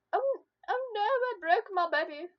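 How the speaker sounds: high, loud mock dismay; mock alarm. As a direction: tease him like he's broken. Boyfriend teasing stunned partner.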